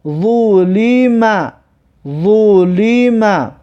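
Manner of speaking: slow syllables with held vowels; drawn-out model reading. Shown as a demonstration. Teacher models a word for learners, slow and drawn out. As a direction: stretch "ظلم" out syllable by syllable. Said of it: "در این کلمه آورده است که".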